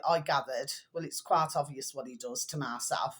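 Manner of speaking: normal talking voice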